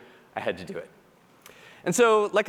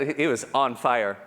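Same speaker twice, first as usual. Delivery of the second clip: ordinary delivery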